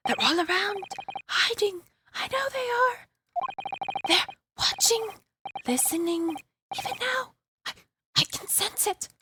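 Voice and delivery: high, hushed spooked whisper-voice; paranoid prisoner. Acted. Prisoner warns of voices in the shadows, high and spooked. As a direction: warn of unseen watchers, high and fearful.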